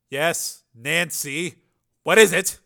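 impatient snap; snapping. A narrator's reading. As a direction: boss snapping at his secretary. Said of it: "he snapped".

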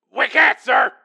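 high, loud frantic report; panicked shout. A narrator's reading. Launch officer answering his commander, panicked shout.

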